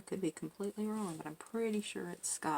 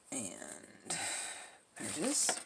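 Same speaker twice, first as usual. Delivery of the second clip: whisper; 3 dB quieter, much slower than usual. Real, not acted. Crafting, murmuring to herself.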